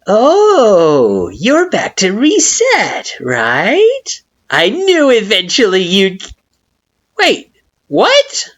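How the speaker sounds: very high, loud, gloating flower voice; gloat, then catch yourself; smug Flowey. A narrator's reading.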